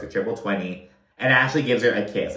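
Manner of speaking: ordinary talk, nothing marked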